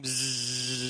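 soft low bee buzz, drawn out; buzzing bee sound. Shown as a demonstration. Pastor imitating grumblers as buzzing bees, soft bzzz. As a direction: hum bzzz like a swarm. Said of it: "Isn't that annoying?".

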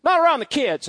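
high, loud scolding aunt; a shocked aunt hushing a cussing uncle; aunt's scolding. Retold or imitated. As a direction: scold him sharply to mind the kids. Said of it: "Just how she'd say it".

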